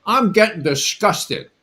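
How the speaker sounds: retold angry reply. Student fires back at the teacher, angry. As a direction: spit the word disgusted back at him. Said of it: "And I got pissed off".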